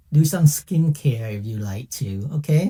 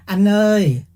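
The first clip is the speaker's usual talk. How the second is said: calling out; 6 semitones higher, 4 dB louder than usual. Retold or imitated. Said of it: "they calling you".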